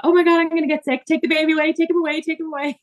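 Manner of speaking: high, fast pleading to take him away; urgent panic. Retold or imitated. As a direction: rush it out, about to be sick. About to be sick after surgery.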